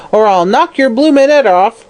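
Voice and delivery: high, vicious crone threat. Acted. Hag threatening the sick woman, high and vicious. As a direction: yell the threat at her. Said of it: "yelled Mother Guttersnipe viciously".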